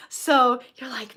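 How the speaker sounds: ordinary talk, nothing marked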